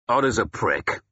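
mildly growled insult; a touch of gruff contempt. Acted. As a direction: growl it, with restraint. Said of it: "Wulfhere growled".

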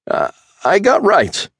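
high, loud whiny protest. A narrator's reading. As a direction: whine it like a coward. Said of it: "Chad's whiny voice".

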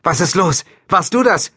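high, loud alarmed questions; urgent call. A narrator's reading. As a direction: shout it into a radio. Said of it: "rief sie ins Funkgerät".

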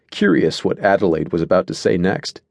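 usual speaking voice

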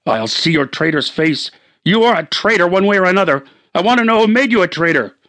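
rising fury at the traitor; angry accusation. Acted. Husband confronts traitor in the light, building anger.

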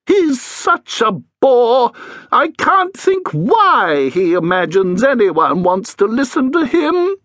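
mock the headmaster's vanity in the cranky ghost voice; high, sneering old ghost; sneering ghost Edith. Edith complaining about headmaster.

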